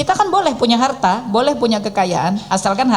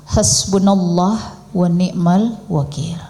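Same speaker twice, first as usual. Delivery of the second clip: slow low devout recitation; 6 semitones lower, much slower than usual. Retold or imitated. Quoting the accepting prayer.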